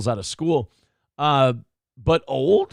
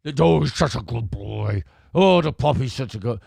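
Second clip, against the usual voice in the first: sing-song pet-praise voice; talking to a dog; 4 semitones lower than usual. Shown as a demonstration. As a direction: coo to a puppy. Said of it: "Isn't that the way you talk to a dog?".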